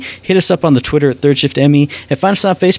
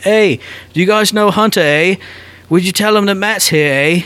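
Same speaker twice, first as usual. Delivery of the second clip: higher-pitched, playful Canadian holler; mock Canadian call; 6 semitones higher than usual. Tourist yelling across the border to Canadians, mock Canadian call. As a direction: call across the water in a put-on Canadian accent. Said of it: "like, a bullhorn and yell across".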